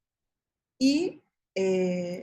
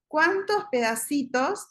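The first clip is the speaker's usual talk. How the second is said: put-on slow, rising question to a small child; 6 semitones higher than usual. Shown as a demonstration. Therapist slowly asking a little boy how many pieces. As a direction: draw it out gently for the child. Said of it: "porque quizás no conoce la palabra".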